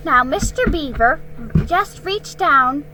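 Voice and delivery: a mother packing in a hurry; busy housewife. Acted. Narrator voices Mrs Beaver.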